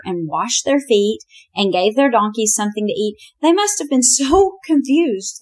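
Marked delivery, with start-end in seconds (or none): 3.4-5.4 amused laugh. Real, not acted. Laughing while retelling the story.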